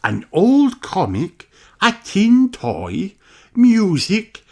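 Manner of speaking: high, slow, kindly teacher voice; a teacher explaining to her class slowly; Madame Gazelle voice. Acted. Teacher telling children about the time capsule, high and slow.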